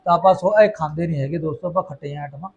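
ordinary talk, nothing marked

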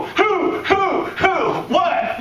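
high alarmed who-who-what. Retold or imitated. Listener overhearing bad news on the phone, alarmed high cries. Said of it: "and you go".

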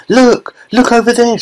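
very high mock scream, not full volume; excited scream. A narrator's reading. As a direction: squeal it like an overexcited child. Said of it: "she screamed".

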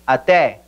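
ATÉ as "ah-TEH"; open stressed e. Shown as a demonstration. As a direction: stress the last vowel of ATÉ, keeping it open. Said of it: "esa E es abierta porque está acentuado".

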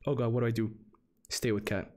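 ordinary talk

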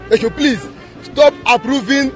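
ordinary talk

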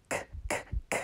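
whispered k, k, k; whispered sounds. Shown as a demonstration. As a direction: breathe out each k. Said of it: "What's the first letter in cat?".

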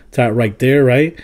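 ordinary delivery

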